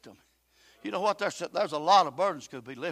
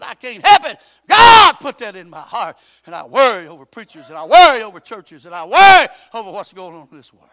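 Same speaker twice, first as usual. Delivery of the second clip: full-force preaching; 2 semitones higher, 20 dB louder than usual. Acted. Preacher urging the congregation, loud and rising. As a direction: drive it home from the pulpit.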